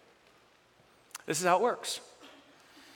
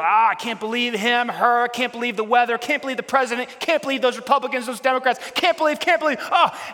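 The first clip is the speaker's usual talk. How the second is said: higher, louder whiny griping, building; a parody of everyday grumbling; mock frustrated complaining; 6 semitones higher, 5 dB louder than usual. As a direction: ham up the exasperated complainer. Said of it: "frustrated and angry at everything".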